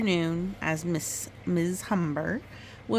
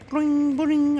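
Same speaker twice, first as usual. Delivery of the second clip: boing sound effect; 10 semitones higher, 8 dB louder than usual. A narrator's reading. Narrator mimics frog's hops.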